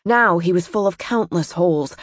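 ordinary delivery, nothing marked

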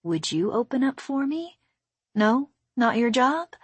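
cooing as if to a beloved gadget; soft coaxing. A narrator's reading. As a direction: softly sweet-talk it with lilting questions. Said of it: "said softly in the voice she used to speak to wires".

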